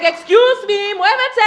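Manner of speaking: high, sassy order to move; brash Puerto Rican woman. Acted. Parade marcher pushing through, high bossy holler. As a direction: clear the way with a long high call. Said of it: "I'm like".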